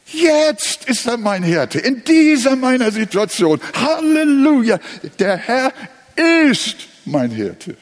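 exultant preaching of the present tense; fervent proclamation. Real, not acted. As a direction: preach it with joy. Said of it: "er ist, er war nicht".

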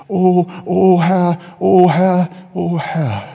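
slow, moaning appeal to God; mock lament. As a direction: wail helplessly, hamming up the despair. Acting the despairing believer's lament.

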